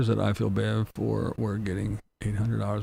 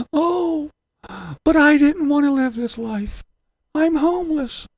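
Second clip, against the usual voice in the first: much higher, louder whiny voice; whiny mock victim; 6 dB louder than usual. Mocking hypocrites who cry homeless, whiny mock voice. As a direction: whine like a fake victim. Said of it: "then you can go".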